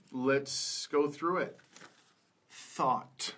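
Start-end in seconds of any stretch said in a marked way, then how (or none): 2.8-3.3 "thought" said carefully with the th at the start; th sound demo. Shown as a demonstration. Teacher models first word for learners, "thought" with initial th. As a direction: model "thought" alone, crisp initial th, falling tone. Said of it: "th sound can come at the beginning".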